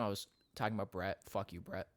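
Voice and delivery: ordinary talk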